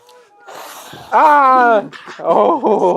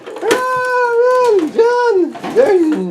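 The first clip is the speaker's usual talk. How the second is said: mock panic; 12 semitones higher, much slower than usual. Playing chased by toy villain.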